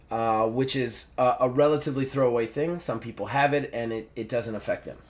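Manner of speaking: soft, low deadpan explanation; imitating an unbothered, matter-of-fact doctor; very casual. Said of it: "Just very casually".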